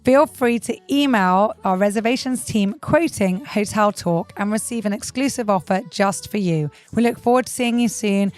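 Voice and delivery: loud, polished outro announcement; ad read. Acted. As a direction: read it like a promo.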